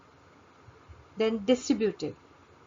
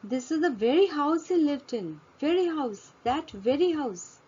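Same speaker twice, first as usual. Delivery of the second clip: very lifted high each time; teacher repeating very house with emphasis; stress on very; 6 semitones higher than usual. Shown as a demonstration. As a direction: lean on very with a falling tune. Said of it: "it is emphasized here".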